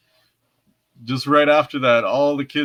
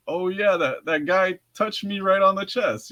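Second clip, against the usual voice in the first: higher, realizing it; dawning realization; 7 semitones higher than usual. Retold or imitated. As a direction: say it as it dawns on you. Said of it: "And then I'm like".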